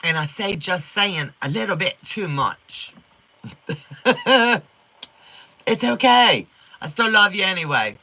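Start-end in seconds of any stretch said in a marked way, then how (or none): 3.4-4.6 short, higher-pitched burst of laughter; amused laugh. Real, not acted. Laughing off viewers' comments about how she talks, amused laugh. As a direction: let out a quick laugh. Laughs at being corrected on speech.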